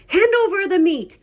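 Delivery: high demanding pirate voice. A narrator's reading. Captain calls for food at the table, demanding. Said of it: "gulped his food and said".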